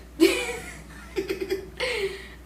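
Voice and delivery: long high giggle; real amused laugh. Real, not acted. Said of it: "that was funny".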